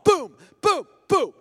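high loud boom, boom; booming sound effect. Pretend. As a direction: shout each boom like an impact. People falling, sound effect.

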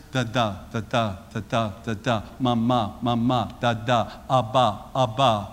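hushed, low heartbeat chant; heartbeat imitation. Shown as a demonstration. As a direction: pulse it out softly like a heartbeat. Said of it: "the heartbeat of the mother is".

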